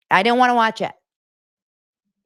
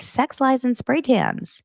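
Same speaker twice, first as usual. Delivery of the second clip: mock chatbot reveal; 9 semitones higher, slower than usual. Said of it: "they were like".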